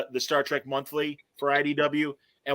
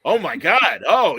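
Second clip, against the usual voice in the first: slow, louder, mock awe; awed Klingons; 3 semitones higher, 7 dB louder, slower than usual. Klingons realizing they face Kirk, hammed-up shock. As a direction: gasp it out in hammed-up dread. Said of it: "the Klingons going".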